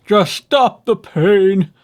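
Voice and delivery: slow, high, loud whimper. A narrator's reading. Fox in pain begging the dentist, whimpering. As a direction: plead through the pain. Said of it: "whimpered the fox".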